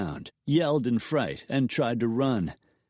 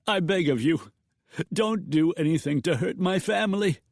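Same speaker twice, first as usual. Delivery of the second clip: higher, frightened begging; cowed pleading; 8 semitones higher than usual. A narrator's reading. Banker begging the outlaws for his family, cowed. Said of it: "the banker pleaded, thoroughly cowed".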